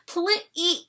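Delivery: high, pleading bird voice; begging please. Acted. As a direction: beg him for the favour. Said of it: "begged the bird".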